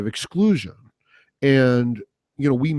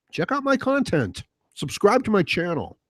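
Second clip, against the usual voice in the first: mock youtuber plug; 8 semitones higher than usual.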